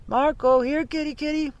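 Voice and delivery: high call for a lost cat, rising in force. A narrator's reading. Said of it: "and he called out".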